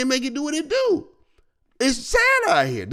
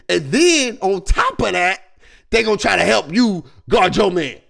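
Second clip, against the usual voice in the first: ordinary talk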